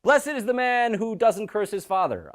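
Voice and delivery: high, slow, drawn-out proclamation; crowd proclaiming. Acted. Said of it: "the people on this mountain say".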